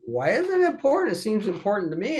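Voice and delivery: imitating his younger self pushing back; retold protest. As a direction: son pressing his father for the truth, high and insistent. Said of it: "And I said".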